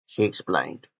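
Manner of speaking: plain reading voice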